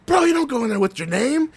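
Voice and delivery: high, urgent mock warning; a hint of a panicked friend; imitating the buddy. Retelling a buddy's warning to a ghost hunter, high mock panic. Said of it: "his buddy's like".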